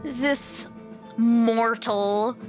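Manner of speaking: normal talking voice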